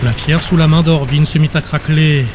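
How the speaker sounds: plain reading voice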